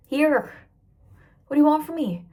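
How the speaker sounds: loud, high, fed-up retort; exasperated retort. Acted. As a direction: burst out in mock exasperation at the questioner. Acted exasperated answer to questioners.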